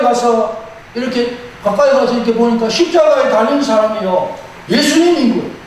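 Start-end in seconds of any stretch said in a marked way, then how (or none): none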